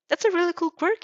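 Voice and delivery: high, loud excited praise; amazed girl. Acted. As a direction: gush with wonder.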